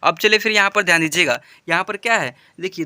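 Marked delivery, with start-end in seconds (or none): none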